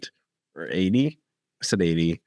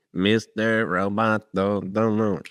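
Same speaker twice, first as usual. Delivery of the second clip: slow, halting robot delivery; slower than usual. Acted. Co-host tries out how her robot would sound, slow robot voice. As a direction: say it word by word like a machine. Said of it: "That's how I would want mine to sound".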